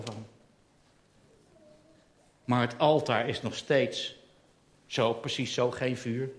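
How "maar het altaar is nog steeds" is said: usual speaking voice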